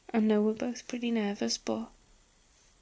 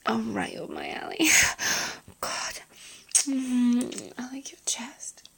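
breathy close-mic whisper. Acted. Whispered intimate roleplay throughout.

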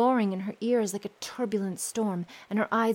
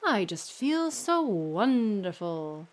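a slow, lower, contented father voice; slow lower happy father; 4 semitones lower, slower than usual. Acted. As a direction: draw out his happiness. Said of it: "the father said".

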